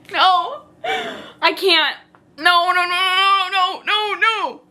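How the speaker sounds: high, loud, pleading string of noes; panicked refusal. Real, not acted. Reader can't bear the cringy scene, wailing refusal. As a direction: beg it to stop, overwhelmed.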